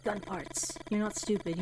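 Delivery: normal talking voice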